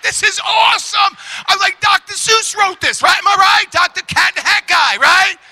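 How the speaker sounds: high, loud gush of discovery; excited young convert. Retold or imitated. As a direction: blurt it out, amazed and thrilled. Acts out his excited first reading.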